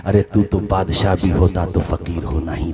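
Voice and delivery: low, soft, grave telling of a fall; hushed storytelling. A narrator's reading. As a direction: draw the crowd in with a hushed voice. Sermon story turns hushed. (धीमी, गंभीर कथा-वाचन की आवाज़)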